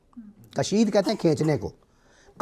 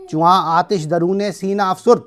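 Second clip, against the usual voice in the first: poem recital; 3 semitones higher, 5 dB louder than usual. Acted. Recites Persian verse.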